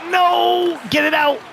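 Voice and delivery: sharp, high shout to clear the puck; panicked yell. Real, not acted. Fan yelling at defenders in the last minute, alarmed.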